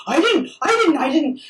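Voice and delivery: high-pitched mimicry of protesting; mock someone squealing their innocence; mocking denial. Accused comic protesting to heckler, mockingly imitated.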